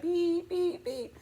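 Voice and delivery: high electronic beep sounds; beep imitation. To viewers mimicking the coffee pot, high beeping. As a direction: mimic the alarm chirping.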